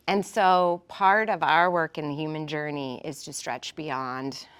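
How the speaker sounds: normal talking voice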